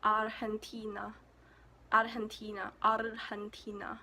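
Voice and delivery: Argentina with a breathy h for the g, ar-hen-TEE-na; Spanish pronunciation. Shown as a demonstration. Vlogger practising the Spanish G, Spanish pronunciation. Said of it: "In Argentina, they speak Spanish".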